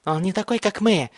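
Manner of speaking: high, crazed cry; frantic yell. A narrator's reading. Mad boy accuses the newcomer, frantic high yell. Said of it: "заорал Бен".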